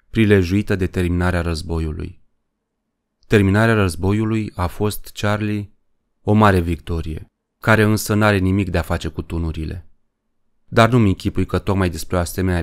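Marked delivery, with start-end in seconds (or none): none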